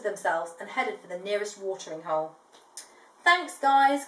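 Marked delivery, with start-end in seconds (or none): 3.2-4.1 louder called thanks; thanking the helpers as they head off; calling thanks. Acted. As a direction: call your thanks to friends leaving. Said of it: "called Crikey and Sheila".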